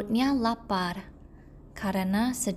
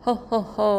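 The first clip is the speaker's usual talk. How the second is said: tawa "ho ho ho" yang keras dan berat; tawa singa; 6 dB louder than usual. Acted. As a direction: tertawa puas seperti singa jahat. Lion gloats over caught mouse. (singa menertawakan tikus, tawa keras)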